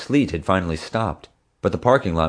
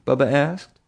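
usual speaking voice

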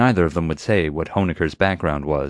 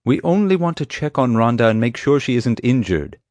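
higher, exasperated protest; 6 semitones higher, 4 dB louder than usual. A narrator's reading. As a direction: plead your case with strained patience. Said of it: "sighed with exasperation".

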